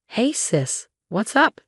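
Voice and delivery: a sister answering, cheerful and high; sounding cheerful. A narrator's reading. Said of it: "sounding cheerful".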